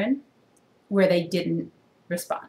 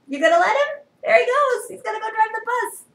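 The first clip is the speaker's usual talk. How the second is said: very high animated storytime voice; reading to children; 6 dB louder than usual. Acted. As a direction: ask the kids in a high, playful voice. Reader asking children.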